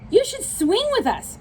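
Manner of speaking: high, bouncy invitation; high monkey call; high playful monkeys. Acted. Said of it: "said the monkeys".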